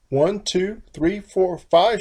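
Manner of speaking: loud, high, rising count of chicks; crying out the count. A narrator's reading. Mother hen cries out counting her chicks, loud and high. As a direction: count them out, alarmed. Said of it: "she cried".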